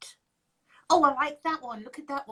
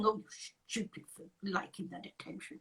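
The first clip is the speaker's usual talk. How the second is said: hushed, breathy mock scolding, much softer; mock scolding whisper; 6 semitones lower, 13 dB quieter than usual. Host whispering the jab they would never say to victims. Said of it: "we are not saying".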